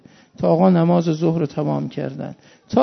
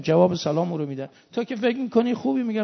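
usual speaking voice

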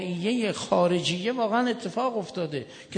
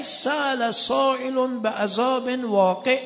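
Quranic recitation; 6 semitones higher, 3 dB louder, slower than usual. Acted. Quran verse recited in sermon.

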